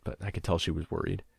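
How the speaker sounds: usual speaking voice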